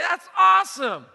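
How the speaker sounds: high, very loud exclamation; retold delighted shout. Pastor thrilled by the man's remark, retold delighted shout. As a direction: burst out with it, re-enacting the delight.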